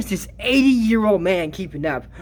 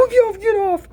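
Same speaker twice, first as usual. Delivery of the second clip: shooing; 13 semitones higher than usual. Retold or imitated. Quote shooing someone away.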